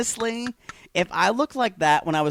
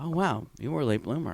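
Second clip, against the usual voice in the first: quieter, judging mimicry; judgmental mocking; 5 dB quieter, faster than usual. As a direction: sneer the judgment into it. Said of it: "did you hear the judgment in her voice".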